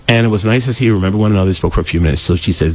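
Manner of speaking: ordinary talk, nothing marked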